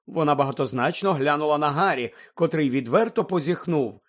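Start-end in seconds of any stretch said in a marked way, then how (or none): none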